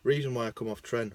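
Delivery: normal talking voice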